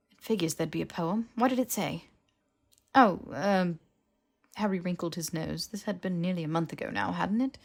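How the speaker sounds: ordinary delivery, nothing marked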